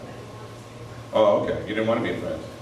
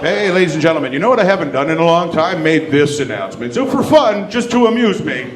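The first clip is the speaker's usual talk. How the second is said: joking pilot announcement; 6 semitones higher, 5 dB louder than usual. Retold or imitated. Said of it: "bullshit a little. Make stuff up".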